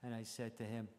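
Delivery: ordinary delivery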